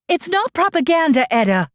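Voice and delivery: high, sing-song mock of her words; mocking imitation. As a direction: parrot her back mockingly. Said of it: "What does that have to do with".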